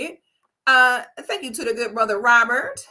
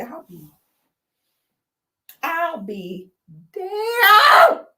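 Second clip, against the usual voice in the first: mock stunned exclamation; 3 semitones higher, 5 dB louder, much slower than usual. Shocked reaction.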